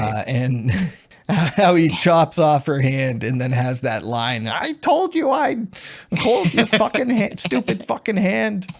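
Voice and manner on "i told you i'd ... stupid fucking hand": high, hammed-up quote of a villain; mock villain's taunt. Fan quotes Doug's line after the hand chop, mock taunt. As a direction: imitate the taunt, breaking into laughs.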